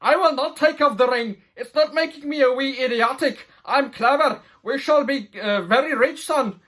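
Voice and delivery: hammed-up smug refusal; lofty mock dwarf king refusing his son; stubborn father. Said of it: "And, and he says".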